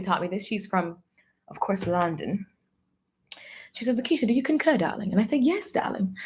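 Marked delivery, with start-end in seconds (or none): 3.9-5.1 lightly put-on London accent, softer at the end; imitated London accent. Mentor's London wife questioning Lakeisha, imitated London accent. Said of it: "It makes me so talk like this". 5.4-6.2 soft, lightly put-on London reply; imitated London accent. Answering the mentor's wife, imitated London accent. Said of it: "It makes me so talk like this".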